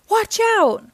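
high, urgent warning cry; alarmed cry. A narrator's reading. Pablo warns as the diamond falls, alarmed cry. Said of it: "Pablo cried as the diamond fell".

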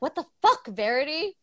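sharp high burst of disbelief; shocked outburst. Retold or imitated. Husband confronting his wife, a high shocked what the fuck. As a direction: fling it at her, stunned and angry. Jeremy confronting Verity, retold.

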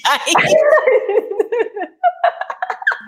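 long, high-pitched laughing; hearty laugh. Real, not acted. Host amused by failed English becoming bestseller, laughing. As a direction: burst into delighted laughter.

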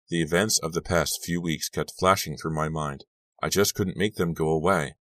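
usual speaking voice

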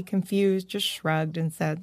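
usual speaking voice

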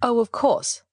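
touch of icy politeness; frosty. A narrator's reading. Niece answering talk of rival, frosty. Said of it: "I said frostily".

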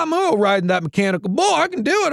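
high, boastful brag; cocky bragging voice; big talker. Retold or imitated. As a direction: puff yourself up bragging. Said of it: "You start talking it up".

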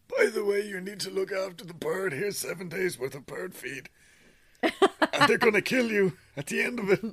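high, singsong-light mocking voice; mock chirpy friend. Friend casually hands over the deadly bird, high mock voice.

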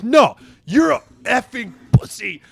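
high, loud, slow mock scream; retold scream. Teammate turning back on the referee, imitated slow screamed insult. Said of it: "just screams it at him".

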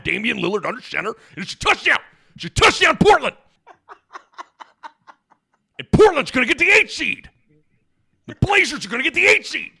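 high, loud touchdown call; excited play-by-play. Pretend. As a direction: scream it like a radio announcer. Mock football play-by-play.

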